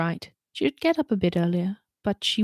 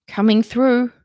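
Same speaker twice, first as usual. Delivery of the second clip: gruff push through a crowd, louder; grunted; 4 semitones higher, 8 dB louder, slower than usual. Acted. Holly pushing through angry protesters, gruff and louder. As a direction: grunt it out while shoving past. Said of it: "she grunted".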